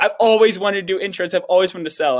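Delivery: high, loud gushing; hammed-up eager plea, high and loud; mock eager beggar. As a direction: gush like a wannabe begging to learn. Mocking eager wannabe to salesman.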